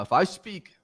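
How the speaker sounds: fighting to stay calm; restrained anger. Real, not acted. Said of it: "I'm holding back right now".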